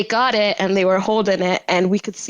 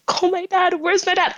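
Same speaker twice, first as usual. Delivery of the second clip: high, panicked calling for dad; retold panic, pitched up; frantic; 9 semitones higher than usual. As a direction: beg for your dad in a panic. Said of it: "I was freaking out".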